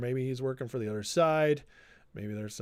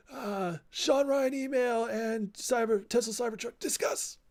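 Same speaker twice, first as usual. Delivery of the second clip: mock talk show host; 8 semitones higher than usual. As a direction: announce the topic like a parody panel host.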